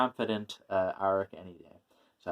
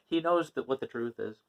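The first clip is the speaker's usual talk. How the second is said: ordinary talk, nothing marked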